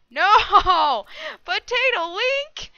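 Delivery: loud, high wail of dismay; drawn-out, high-pitched yell; anguished shout. Real, not acted. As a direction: cry out at the player who got you. Killed by another player.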